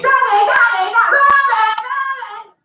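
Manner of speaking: put-on high child calling for daddy; child screaming daddy. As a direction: wail daddy like a small child in the night.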